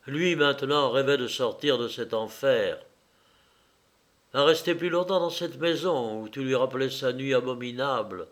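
plain reading voice

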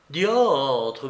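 plain reading voice

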